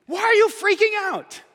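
high, emphatic why are you freaking out; lively Jesus question. Retold or imitated. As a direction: press them with energy. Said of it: "it is what he's saying".

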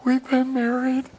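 high-pitched mock old couple boasting; bragging grandparents. Grandparents bragging about their marriage, mocking high-pitched boast. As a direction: sing out the boast in a put-on high voice. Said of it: "they would always brag".

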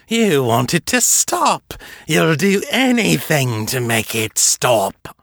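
slow, high, silky menace; taunting vampire. Acted. Fisher taunting the sobbing Seffi, slow and silky. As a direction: draw it out like a predator.

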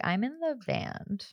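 soft, slow little-guy brag. Retold or imitated. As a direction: play a small guy, quietly and slowly. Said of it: "He's a little guy".

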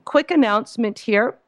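ordinary talk

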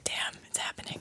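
hushed, barely-there whisper; awed whisper. Retold or imitated. Couple locking eyes across the dance floor, awed whisper. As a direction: whisper it like a shared secret.

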